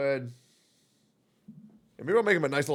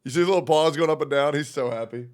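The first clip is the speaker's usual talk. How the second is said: ordinary talk, nothing marked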